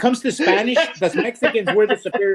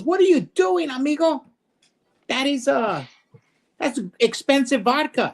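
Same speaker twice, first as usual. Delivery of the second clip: high-pitched protest; alarmed Mexican cowboy; 7 semitones higher than usual. Acted. Joke's Mexican protesting wasted vodka, high-voiced and aghast. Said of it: "the Mexican guy goes".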